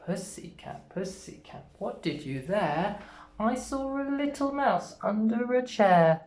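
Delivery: sing-song rhyme, partly hushed; slow sing-song rhyme; nursery rhyme. Shown as a demonstration. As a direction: recite it for little children. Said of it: "nursery rhyme recited".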